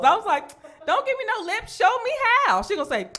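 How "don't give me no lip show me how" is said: high sassy mom scold; high mock scolding. Mom scolding daughter, demanding she show how, high sassy. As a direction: snap back high and sassy.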